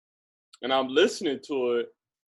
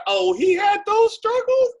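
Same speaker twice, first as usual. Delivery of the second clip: very high, louder incredulous question; surprised disbelief; 5 dB louder than usual. Retold or imitated. Friend hearing about his hidden struggles, astonished. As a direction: let the voice leap up in astonishment.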